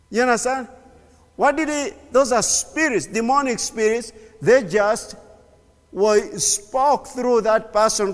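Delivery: high, loud fiery preaching; preaching at full force. Acted. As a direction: fire it at the congregation.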